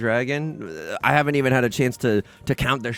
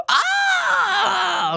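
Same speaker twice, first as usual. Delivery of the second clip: anguished cry; 4 dB louder than usual. Real, not acted. Frustration at opponent's win.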